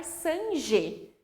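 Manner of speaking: sangue said as sanje, with a zh sound; soft G like J. Shown as a demonstration. Teacher showing sangue without its U, soft J sound. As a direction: say sangue with g turned into French-style j. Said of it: "o mesmo som de J".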